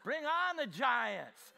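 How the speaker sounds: put-on defiant old Caleb, shouting; bold battle shout. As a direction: roar like an old warrior. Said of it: "Caleb said at eighty".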